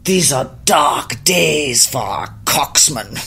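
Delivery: grand, slow imitation with drawn-out words; David. Singer blowing smoke into the car, grandly imitated complaint. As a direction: pronounce it like a doleful verdict, mock-solemn. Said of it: "and goes".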